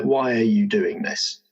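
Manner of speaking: ordinary delivery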